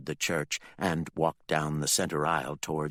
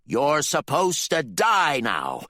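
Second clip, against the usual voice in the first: slow, higher cruel command; cold menacing father; 9 semitones higher, 6 dB louder, slower than usual. Acted. The father orders Candy to die, slow and cruel.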